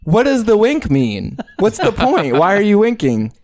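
confused questioning. Retold or imitated. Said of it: "And you're like".

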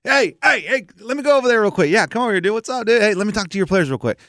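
high, eager interjecting coach; Jimmy Allen. Retold or imitated. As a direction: imitate a keen helper butting in. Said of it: "Oh, it's Jimmy".

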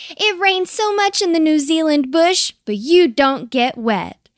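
high, loud explaining voice; eager little know-all. A narrator's reading. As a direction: announce a silly theory with full conviction. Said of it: "answering his own question".

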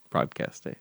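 breathy whispered echo. Acted. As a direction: whisper it like an aside. Catchphrase echoed in a whisper.

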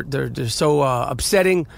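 ordinary talk, nothing marked

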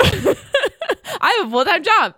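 high, slow repeat broken up by laughter; giggling mock protest; laughing echo. Real, not acted. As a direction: echo the line back while cracking up.

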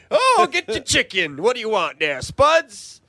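high southern fried-chicken server; exaggerated high-pitched southern accent. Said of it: "most amazing southern accent I've ever".

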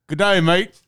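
put-on Australian accent. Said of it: "in an Australian accent".

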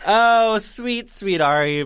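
high, loud adoring gush. Acted. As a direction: swoon over her.

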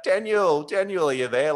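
mock yell up toward a distant window; retold calling out. Calling up to a comedian's hotel from the park, yelling out. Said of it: "yelling out".

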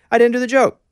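excited realization. Retold or imitated. Retold surprised realization on stage.

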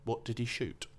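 ordinary talk

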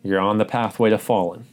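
ordinary talk

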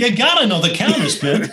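high, hammed-up sage advice; mock coach voice. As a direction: say it like a smug guru. Mocking repeat of phrase.

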